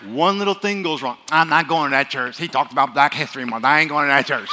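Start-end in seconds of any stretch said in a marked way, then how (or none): 1.3-4.5 loud mock-offended churchgoer; hammed-up complaint about the pastor; huffy churchgoer. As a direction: play a sulky member storming off. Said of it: "like basketball Christians".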